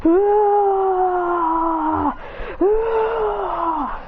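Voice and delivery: high screams of falling. Acted. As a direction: scream while flying out. Trunks knocked out of arena.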